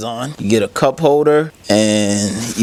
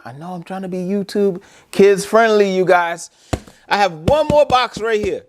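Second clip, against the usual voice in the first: much higher, strained voice; worked up and venting; agitated; 9 semitones higher than usual. Real, not acted. Reviewer venting to viewers about the missing couch, worked up.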